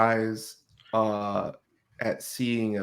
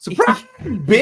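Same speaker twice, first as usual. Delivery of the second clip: much higher, loud, slow yell; shouted taunt; 12 dB louder than usual. Pretend. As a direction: yell it as you spring the ambush. Surprise attack on the enemy.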